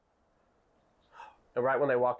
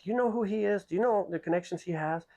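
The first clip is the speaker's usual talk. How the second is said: exasperated boss; 9 semitones higher than usual. Retold or imitated. Boss scolding his staff.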